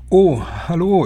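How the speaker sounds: high, loud sing-out hello; grandmother's cheery greeting. A narrator's reading. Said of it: "erklang da die Stimme meiner Großmutter".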